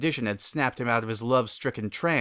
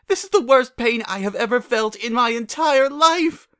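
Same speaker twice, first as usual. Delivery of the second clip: pained wail; 12 semitones higher, 7 dB louder than usual. Acted. Rarity in pain.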